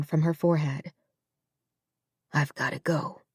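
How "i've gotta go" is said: soft, heavy-hearted; barely getting it out; quiet goodbye. Acted. Son leaving his dying mother, quietly.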